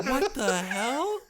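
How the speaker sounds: high, slow, drawn-out disbelief; shocked outburst. Real, not acted. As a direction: stretch out the hell in disbelief.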